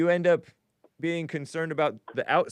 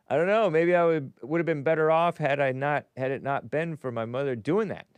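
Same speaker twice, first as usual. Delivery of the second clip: ordinary delivery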